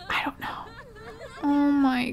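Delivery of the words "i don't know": hushed, anxious whisper. Real, not acted. Streamer dreading the Grim Reaper, anxious whisper. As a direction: breathe it out, afraid to look.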